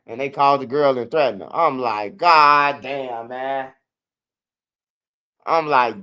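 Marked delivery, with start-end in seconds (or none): 2.2-3.7 loud, drawn-out god damn; a touch of frustrated disbelief; exasperated curse. Retold or imitated. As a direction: groan it out like you're busted. Reacting to being taken away.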